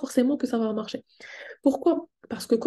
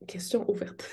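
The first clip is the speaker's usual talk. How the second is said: question ouverte chuchotée; 7 dB quieter than usual. Real, not acted. Aparté chuchoté au public.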